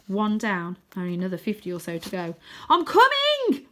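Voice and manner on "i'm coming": big high shout across the rocks. Acted. Arthur calling out to Grandad, loud high shout. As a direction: yell it out at full voice. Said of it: "I shout".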